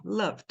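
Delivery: "loved" as one syllable, luhvd; run-together ending. Shown as a demonstration. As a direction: silent E in "loved", V and D joined. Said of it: "que sai assim".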